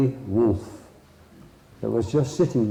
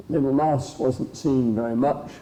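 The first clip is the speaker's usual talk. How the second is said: plain reading voice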